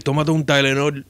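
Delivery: a hint of a dismissive old doctor; old Cuban doctor. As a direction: play the lazy old Cuban doctor, bored and unhurried. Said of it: "he would be like".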